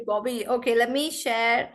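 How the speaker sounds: ordinary delivery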